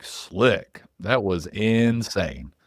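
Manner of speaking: ordinary talk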